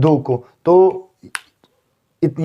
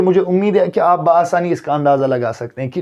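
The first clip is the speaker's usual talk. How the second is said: ordinary delivery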